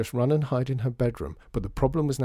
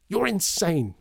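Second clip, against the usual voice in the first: higher, exasperated outburst; angry shout; 8 semitones higher, 3 dB louder, slower than usual. A narrator's reading. Veruza ending a row with John, shouting. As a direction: throw it at them as a raised-voice accusation. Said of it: "shouted Veruza".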